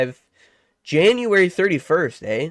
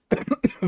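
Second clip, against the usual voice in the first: quiet muffled cough; small cough, nearly silent; soft cough; 4 dB quieter than usual. Real, not acted. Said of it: "for me being a little under the weather here".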